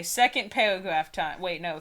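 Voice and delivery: ordinary delivery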